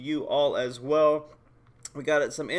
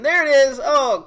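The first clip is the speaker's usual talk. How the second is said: excited outburst; 7 semitones higher, 8 dB louder than usual. Real, not acted. Power nearly hits one million.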